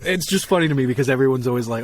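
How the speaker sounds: ordinary talk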